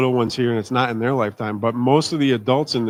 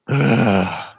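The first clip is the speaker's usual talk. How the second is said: groan of disgust. Real, not acted. Disgust after youth story.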